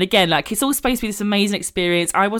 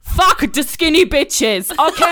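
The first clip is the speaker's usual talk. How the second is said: put-on high, slow, loud Georgian-accented pep talk; 9 semitones higher, 4 dB louder, slower than usual. Seamstress Nini rallies the bride, loud Georgian accent. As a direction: belt it like a fierce Georgian seamstress. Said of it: "I'm gonna try and do her accent".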